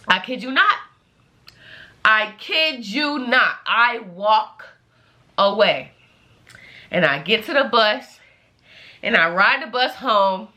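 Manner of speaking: normal talking voice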